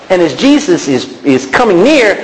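ordinary talk, nothing marked

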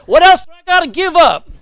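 crying out high and loud, at the end of his rope; loud, high desperate plea; desperate shout. Retold or imitated. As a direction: yell it like a broken drunk begging the preacher. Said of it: "He says".

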